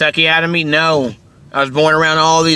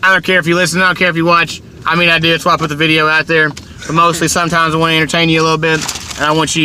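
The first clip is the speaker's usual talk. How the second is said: ordinary talk, nothing marked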